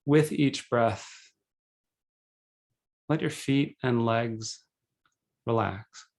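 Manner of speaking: calm, measured meditation guide with long pauses; guided meditation. Acted. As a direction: guide them gently into breathing, unhurried.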